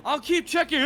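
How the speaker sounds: high, loud call cut short; Vorton. Acted. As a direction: call it out as you get dragged away. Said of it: "as Vorton's saying".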